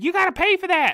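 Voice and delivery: high, full-voiced accusing screech; screaming old lady. Imitating a store worker chasing a customer, screaming. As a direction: shriek it to stop someone leaving. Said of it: "and she's like".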